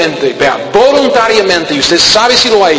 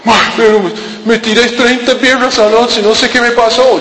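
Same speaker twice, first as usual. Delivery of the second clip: whiny excuse; 4 semitones higher than usual. Retold or imitated. Imitated hungover youth excusing.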